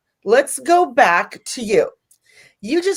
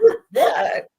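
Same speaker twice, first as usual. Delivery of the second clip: much higher, baffled question; puzzled surprise; 12 semitones higher than usual. Retold or imitated. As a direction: squeak it out, bewildered. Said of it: "I'm like this".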